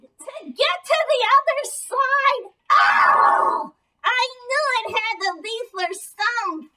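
scandalized outburst; mock shock. Dummy feigns shock at answer.

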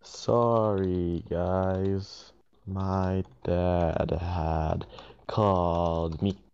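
very slow, drawn-out words; typing aloud. Real, not acted. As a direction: stretch each word out while typing it. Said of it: "I'm gonna type".

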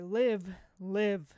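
loud forceful Live, live; Mister Dark's booming order; demanding command. Acted. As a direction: hammer the words at the air. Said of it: "hammering demand upon the air".

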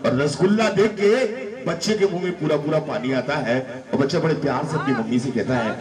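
ordinary talk

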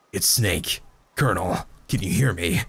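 deep low gruff soldier voice; Snake voice. Acted. Reading Snake's lines in Snake's voice.

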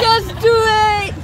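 loud slow shouted slogan; shouting. Pretend. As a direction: bellow it out, stretched.

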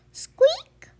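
very high breathy squeak; one sharp little squeak; tiny squeak. Acted. Baby creature answering Fox, squeaking.

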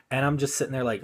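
ordinary delivery

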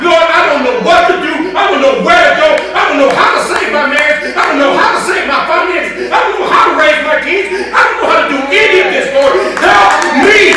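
loud, high crying out to God; desperate shouted prayer. Acted. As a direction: beg the Lord for help at the top of your voice. Acted desperate prayer cry.

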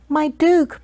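high-pitched, humble appeal; pleading. A narrator's reading. Baker facing banishment, high pleading voice. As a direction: beg the Duke for mercy. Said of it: "pleaded Walter".

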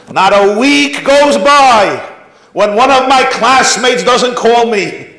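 high, loud, slow preacher build; preaching. Real, not acted. Rabbi telling parents his story, preaching loud. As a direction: drive it home to the crowd.

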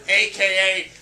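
high, loud, slow hype; laughing, loud mock announcement; hammed-up announcement. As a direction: oversell the date like a big reveal. Hype about January fifth.

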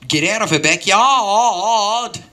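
hammed-up Australian command; Australian accent. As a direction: order them off in an Australian accent. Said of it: "You sound like an Australian".

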